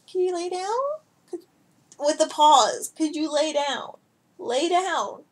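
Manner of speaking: high pleading pet voice; lightly high coaxing; pleading with cat. Real, not acted. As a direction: beg the heavy cat to lie down. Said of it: "I talk to my cats like they're children".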